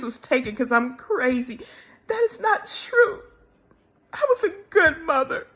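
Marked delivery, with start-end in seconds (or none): 2.1-5.5 high, breaking voice through real tears; sobbing as she insists, voice cracking; crying. Real, not acted. Woman tells viewers she was a good mother, crying.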